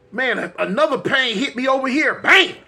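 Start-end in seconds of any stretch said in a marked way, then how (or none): 2.2-2.5 shouted high bang; loud mock impact. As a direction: slam out the hit. Second stomach pain hits.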